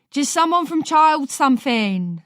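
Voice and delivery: wife shouting back to her husband inside. A narrator's reading. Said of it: "Margaret shouts".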